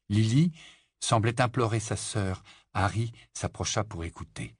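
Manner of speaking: ordinary delivery, nothing marked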